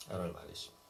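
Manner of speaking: a hushed, lingering repeat; quiet echo. Shown as a demonstration. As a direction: let the words sink in, hushed.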